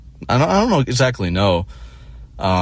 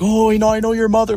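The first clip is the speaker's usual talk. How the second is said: high mock salon-lady voice; mocking ladies; 13 semitones higher than usual. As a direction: ape the chatty women up high. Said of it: "they're like".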